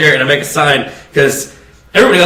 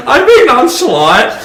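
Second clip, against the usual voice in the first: much higher, slow airy put-on voice; nonchalant voice; 9 semitones higher, slower than usual. Shown as a demonstration. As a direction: say it breezily high and unbothered. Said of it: "my nonchalant voice is".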